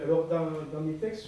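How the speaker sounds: normal talking voice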